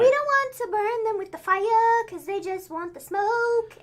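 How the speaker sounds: high, drawn-out mimicking voice; stretched, high mock hype; mock wrestler promo. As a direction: imitate the team's promo in a slow, high sing-song. Acts out the New Day again.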